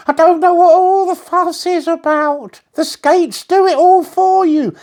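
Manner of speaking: breezy, boastful crocodile on skates; cheerful crocodile. A narrator's reading. Crocodile voice, carefree.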